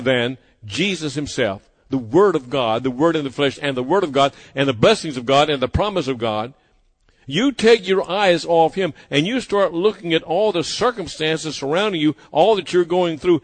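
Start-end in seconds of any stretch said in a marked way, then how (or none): none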